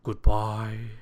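loud, level, drawn-out farewell; emphatic send-off at full voice; called out. A narrator's reading. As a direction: call goodbye after a departing guest. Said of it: "called Mister Fussy".

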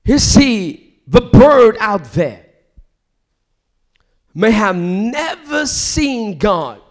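loud, rising sermon cry; fiery preaching. Acted. Preacher crying out to the congregation, fiery. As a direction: preach it out with full force.

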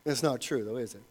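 ordinary talk

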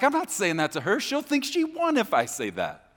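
high, loud indignant protest; arguing back. Acted. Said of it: "I'll argue back sometimes".

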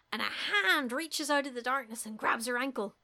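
usual speaking voice

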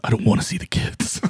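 whispered. Acted. Unvoiced mock reply.

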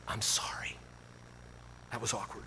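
barely audible, whispery apology; very soft, confidential cringe; embarrassed apology. Retold or imitated. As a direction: apologize under your breath. Wished-for embarrassed apology to barista.

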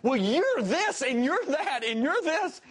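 high, drawn-out mock tirade; mock railing. Employee railing at his boss after a correction, mock railing. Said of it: "he starts railing on me".

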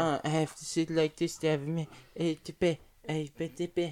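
mumbled, groveling mimicry of a friend; Max. Mimicking a friend's headphone complaint, mumbled mock impression. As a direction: imitate your friend with half-formed, trailing words. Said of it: "That was a great impression".

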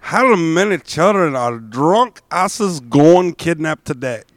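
slow, put-on drunken-typist accent, misspellings and all; Michael Neff accent. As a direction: read it slowly in a mock personal accent. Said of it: "do it in a Michael Neff accent".

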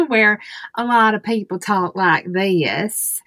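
slow Southeastern US drawl; Southern US accent. Shown as a demonstration. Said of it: "here in Southeast United States".

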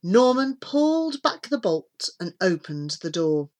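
plain reading voice

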